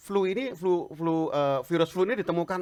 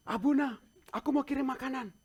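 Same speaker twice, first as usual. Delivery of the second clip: eager friend; 12 semitones higher than usual. Retold or imitated. Friend from Dubai voiced.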